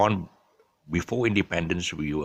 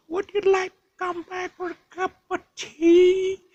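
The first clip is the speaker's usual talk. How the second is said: very high, loud mock-genteel British lady; mum's English accent; 5 dB louder than usual. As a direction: trill it as an exaggerated British tea invitation. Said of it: "she inculcated the English".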